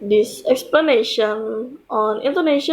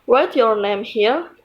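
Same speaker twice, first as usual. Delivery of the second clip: ordinary talk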